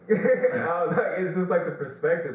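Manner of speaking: talking high and loud through laughter; laughing. Real, not acted. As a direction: still giggling while trying to explain.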